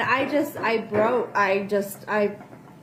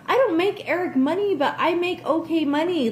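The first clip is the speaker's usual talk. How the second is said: ordinary talk, nothing marked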